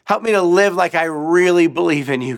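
loud, high desperate plea to God; crying out. Retold or imitated. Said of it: "he cried out".

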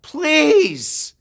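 high, loud cry for help; urgent high-pitched plea; called out plea. Retold or imitated. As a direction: shout desperately to someone across the water. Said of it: "I called out".

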